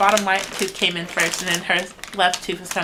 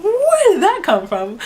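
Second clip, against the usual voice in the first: surprised; 12 semitones higher, 8 dB louder than usual. Real, not acted. Mom reacting to baby grunt.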